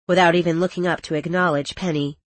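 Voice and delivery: usual speaking voice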